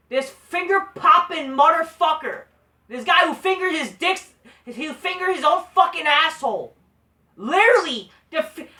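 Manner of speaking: loud, high-pitched, exasperated cursing; angry rant. Real, not acted. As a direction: build up in loud indignation. Rant building against Ricochet.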